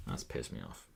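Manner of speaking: low, very soft grumble; quiet, deep grumble of annoyance; annoyed mutter. Real, not acted. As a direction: mutter it low, half to yourself.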